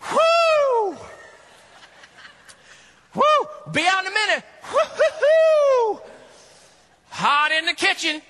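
high, loud hooting call from the kitchen; hammed-up flustered cook calling out; mock Martha calling out. Martha calling from a steaming kitchen, loud and high.

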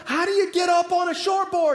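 high, loud call across the water; yelled out. Retold or imitated. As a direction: shout it out to the instructor from afar. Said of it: "I finally yelled out".

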